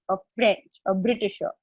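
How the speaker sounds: normal talking voice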